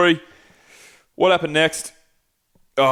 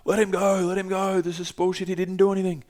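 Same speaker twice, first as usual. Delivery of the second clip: higher-pitched mock Portuguese accent, pleading; imitating a Brazilian friend with a Portuguese accent, pitched up; 6 semitones higher, 3 dB quieter than usual. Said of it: "That was my Portuguese accent".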